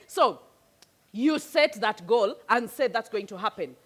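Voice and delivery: ordinary delivery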